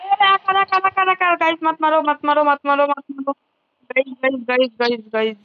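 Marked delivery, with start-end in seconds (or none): 0.0-3.3 high rapid-repeat begging, sinking in pitch; alarmed begging that gradually settles; panicked pleading. Real, not acted. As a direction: plead frantically not to be hit. Begging not to be shot mid-game.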